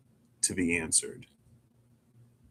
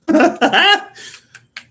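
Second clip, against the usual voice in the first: big high burst of laughter; loud laugh; 13 dB louder than usual. Real, not acted. Laughing out loud at her own tarot joke.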